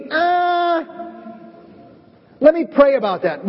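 high, hesitant stalling voice; mock pious hedging; fake reluctance. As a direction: dodge the request while sounding holy. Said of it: "is like a fake thing".

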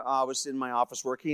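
normal talking voice

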